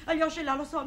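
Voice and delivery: ordinary talk, nothing marked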